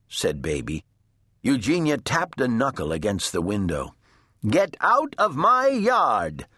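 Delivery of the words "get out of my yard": high, drawn-out indignant shout. A narrator's reading. Eugenia shouts at the pig in her yard, indignant shout. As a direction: order the intruder out, high and slow. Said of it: "she shouted at Mercy".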